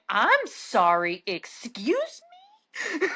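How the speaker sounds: slow, incredulous comeback; affronted slow excuse me; sharp tone change. Retold or imitated. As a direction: let the politeness drop, slowly. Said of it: "my tone changed real fast".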